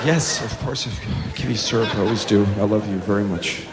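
very soft, low, patient voice; placating dad. Acted. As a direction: soothe a tyrant toddler in an undertone. Placating dad answers screaming child.

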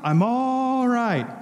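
"I'm all right" stretched very slow and high, in a put-on voice; a slow, high, reassuring cry of "I'm all right"; drawn-out mock cry. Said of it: "he screams off the camera".

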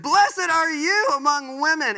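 high, loud and slow proclaiming; Elizabeth's joyful shout of blessing; loud exclamation. Acted. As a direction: cry it out with joy. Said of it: "she exclaimed with a loud voice".

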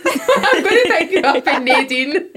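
laughing through the words. Real, not acted. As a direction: giggle as you say it.

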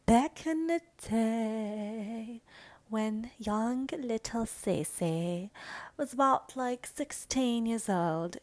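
slow, drawn-out British storytelling voice; British accent. Pretend. Telling of young Cece at sixteen, slow British accent. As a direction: play at British, stretching the words. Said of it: "like, this British voice".

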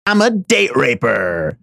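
high-pitched, hammed-up sleazy braggart; mocking Russell impression. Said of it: "My name's Russell".

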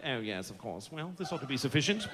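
a lightly voiced lord paying off a servant; quiet mock-aristocrat; disdainful lord.

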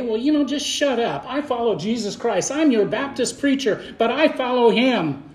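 preaching scripture. Acted. Reading scripture aloud, slow and emphatic.

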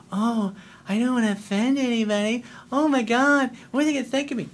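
high, timid put-on voice; mock someone fretting over what others think; mock people-pleaser. Mimicking people afraid of offending others.

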